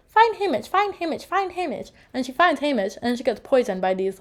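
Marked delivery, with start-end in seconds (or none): 0.1-1.9 put-on like a child on remote control, trailing off; eerie repeated command. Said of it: "She goes".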